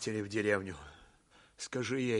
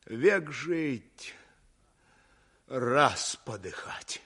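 ordinary delivery

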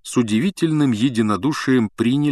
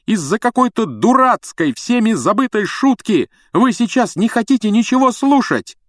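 high, loud pleading yell; desperate shouting; 13 semitones higher, 5 dB louder than usual. A narrator's reading. Harry shouting at Snape who won't listen. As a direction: keep yelling to break through.

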